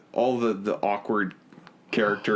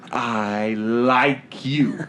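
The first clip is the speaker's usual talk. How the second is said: very drawn-out "I like you", every word stretched; exaggerated slow declaration; 4 dB louder, much slower than usual. Shown as a demonstration. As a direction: stretch "I... like... you" out heavily for comic effect. Exaggerated repeat of the line.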